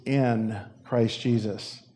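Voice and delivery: "in" said slowly and pressed, "Christ Jesus" falling soft; key word stressed. Shown as a demonstration. Preacher pointing out the verse's key word, slow stress on "in". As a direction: linger on "in" to mark it as the key word. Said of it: "The key word".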